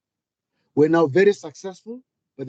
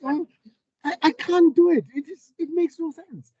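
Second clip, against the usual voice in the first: exasperated; 12 semitones higher than usual. Real, not acted. Exasperated at switching personas.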